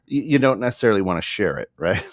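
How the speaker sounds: ordinary delivery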